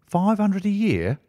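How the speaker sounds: mild mock shout of astonishment, pitched high; astonished exclamation. A narrator's reading. Clerk astonished at the salary offer, high incredulous cry. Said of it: "I shouted".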